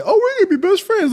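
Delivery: high, fast sing-song taunt; mock Emily. Mocking Emily's cheery lack of fucks in a high chirp. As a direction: chirp it like a carefree brat. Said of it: "the lack of fucks from Emily".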